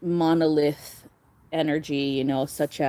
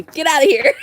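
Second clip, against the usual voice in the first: louder, higher laughing warning; playful louder mock warning; 10 semitones higher, 8 dB louder than usual. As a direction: bark it out through a laugh. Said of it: "They'll warn you, like me".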